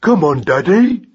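slow, high lad talking through food; thick-tongued, munching boy; mouth full. A narrator's reading. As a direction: urge Dad on through a big mouthful. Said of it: "said Magnus with his mouth full".

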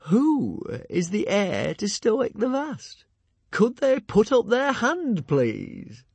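high, slow, barely holding back a laugh; struggling to keep a straight face; suppressed laughter. A narrator's reading. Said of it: "struggling to keep a straight face".

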